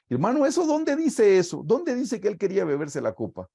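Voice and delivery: high, challenging voice of a doubting churchgoer; mock skeptic. Preacher mimics a church member challenging his claim, high and incredulous. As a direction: play the heckler who questions the preacher.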